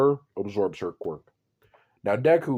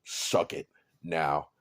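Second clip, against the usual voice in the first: menacing low command; 6 semitones lower, 5 dB quieter, slower than usual. Acted. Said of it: "He's like".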